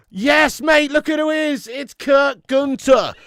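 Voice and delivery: high, loud buzzing shout of thanks; excited. Real, not acted. Streamer celebrates a big donation, excited loud shout.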